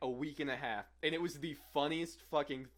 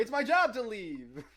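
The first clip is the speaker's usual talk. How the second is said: indignant; 8 semitones higher, 7 dB louder, slower than usual. Real, not acted. Indignant protest.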